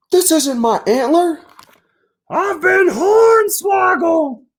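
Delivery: very high, cartoonish deer crying out in dismay, slowing into a shout; a panicked deer wailing at his reflection; shocked deer. Deer discovers stolen antler.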